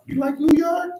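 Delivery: very high, hammed-up asking voice; squeaky mock question. Streamer plays a second voice asking the migrant, squeaky falsetto. Voicing imagined dialogue.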